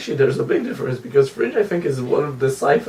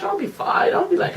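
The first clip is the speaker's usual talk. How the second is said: high mock voice; 4 dB quieter than usual.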